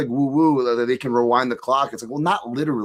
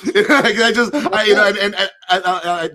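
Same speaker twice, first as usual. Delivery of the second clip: laughing; 8 semitones higher, 7 dB louder than usual. Real, not acted.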